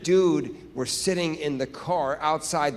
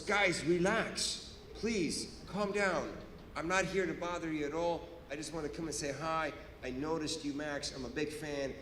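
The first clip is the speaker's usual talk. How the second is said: soft, lowered, calming voice; reassuring officer; 3 semitones lower, 9 dB quieter than usual. Retold or imitated. Officer soothing two scared men in car, soft reassurance. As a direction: gently put them at ease, imitating a friendly cop.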